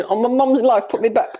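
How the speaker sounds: high put-on roadman voice; mock street-baby protest; newborn roadman. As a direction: swear like an indignant baby roadman. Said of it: "comes out the womb talking".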